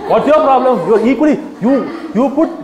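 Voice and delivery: laughing while mocking the objection; words spilling out through a laugh. Real, not acted. Speaker laughing at the audience's argument, laughing.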